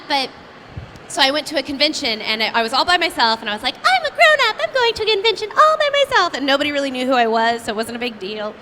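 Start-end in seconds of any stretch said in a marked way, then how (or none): 3.8-6.3 put-on very high, fast childlike boast; high, fast mock-proud little voice; proud kid voice. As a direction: sound like a kid proud to be grown up. Quoting her proud younger self.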